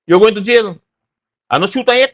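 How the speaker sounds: police officer telling a man with a gun he is under arrest, loud and stern. Retold or imitated. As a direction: bark the arrest at the gun owner.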